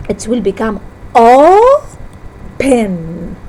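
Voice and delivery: o-pen said slowly with a break between "o" and "pen"; syllables split apart. Shown as a demonstration. Teacher shows the students the split, slowly. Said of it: "we split between them".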